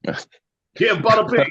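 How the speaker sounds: put-on Jersey wiseguy accent; Jersey accent, playful wiseguy. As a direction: toss it off in a Jersey mobster accent. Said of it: "The old Jersey accent".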